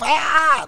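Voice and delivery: mock panicked yell. Running off again, mock panic.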